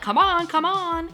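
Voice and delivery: high, hurried come-on; mock urgent urging; urgent call. Dustin urging Eddie to hurry.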